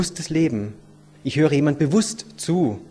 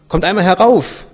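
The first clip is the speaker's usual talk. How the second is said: loud, higher motherly summons; raised, insistent acted call; 5 semitones higher, 8 dB louder than usual. A narrator's reading. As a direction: call the children in from the window. Mother calls children upstairs.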